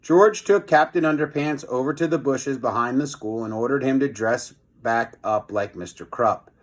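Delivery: ordinary delivery, nothing marked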